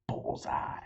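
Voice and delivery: triumphant whisper; hushed little victory. A narrator's reading. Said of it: "whispered punchline".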